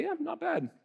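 high, soft grudging praise; mock understatement. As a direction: shrug it off as barely ok. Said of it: "God could say".

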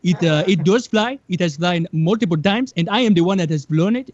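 mock Nigerian accent, earnest insistence; Nigerian pilot impression. Man showing off his homemade helicopter to a reporter, Nigerian accent. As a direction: imitate a proud amateur pilot, Nigerian-accented. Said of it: "the guy himself doesn't even talk much".

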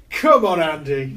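loud cheer at the telly; cheering shout. Retold or imitated. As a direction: shout like a fan cheering on a player. Cheering on tennis on TV.

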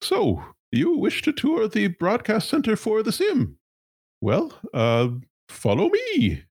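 high, nervous corporate stammer; a flustered high-pitched executive. Acted. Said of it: "And she says".

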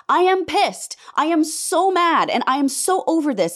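furious and done with it; angry. Real, not acted. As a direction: let the real anger push through. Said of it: "you can hear the anger in my voice".

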